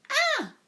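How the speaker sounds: loud high shriek; mother screams in alarm; startled high "Ah!". A narrator's reading. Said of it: "and she screamed too".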